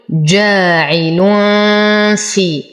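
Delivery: slow جاعل في with a light hummed ghunna before fa; light ikhfa. Shown as a demonstration. Teacher reciting for students, جاعل في with light ghunna. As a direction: hide the nun of جاعل في in a nasal hum. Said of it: "فالحكم إخفاء مع غنة".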